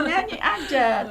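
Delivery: high, loud and stretched-out urging; emphatic encouragement. Retold or imitated. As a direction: urge them on, drawing the words out. Retells urging people to just sing.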